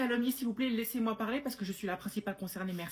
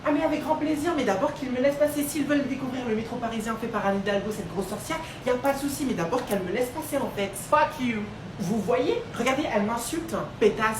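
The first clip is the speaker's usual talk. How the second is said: loud, heated complaining; a Parisian loudly complaining about the tourists; angry ranting; 2 semitones higher, 6 dB louder than usual. Acted. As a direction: rant at the stranger. Arguing with a Belgian in the metro.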